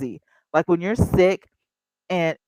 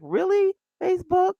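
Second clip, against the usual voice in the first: much higher, rising disbelief; really, Facebook; incredulous question; 13 semitones higher than usual. Retold or imitated. Host mocking Facebook for deleting her stream, incredulous.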